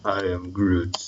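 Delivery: a quiet, far-off hint of Groot; Groot impression. Teacher naming a variable quotes Groot, lightly voiced. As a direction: murmur it like the tree creature. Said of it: "We'll call it Groot".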